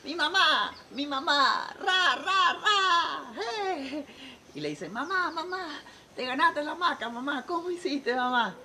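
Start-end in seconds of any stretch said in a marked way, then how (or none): 0.0-4.1 high, loud mock cheerleader chant; hammed-up high victory cheer; overjoyed cheering. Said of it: "las muchachas contentísimas". 4.9-8.6 put-on high, excited girlish voice; acted excitement, pitched way up; overjoyed daughters. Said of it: "las muchachas contentísimas".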